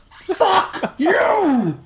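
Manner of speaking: high, drawn-out yell; long, high-pitched furious shout. A narrator's reading. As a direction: scream each word in fury. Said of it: "As Cronish shouted this".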